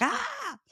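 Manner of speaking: very high short yelp; imitated yelp of annoyance; frustrated mock yelp. Imitating a driver hit by a sunbeam, mock yelp. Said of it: "sunbeam hits his face and he's like".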